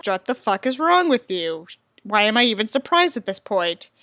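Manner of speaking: ordinary talk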